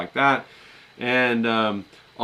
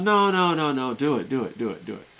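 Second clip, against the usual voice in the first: insistent urging; 4 semitones higher than usual. Retold or imitated. Retold friend overriding his reluctance.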